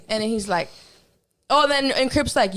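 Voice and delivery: normal talking voice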